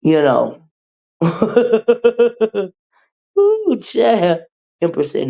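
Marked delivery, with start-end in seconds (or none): none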